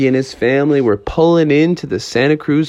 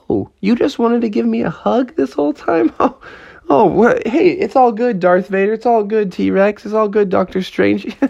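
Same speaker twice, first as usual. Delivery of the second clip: higher-pitched, astonished realisation; surprised relief; 9 semitones higher than usual. Acted. Froggy telling his toy allies all is fine, relieved. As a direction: call everyone off in a high, relieved voice.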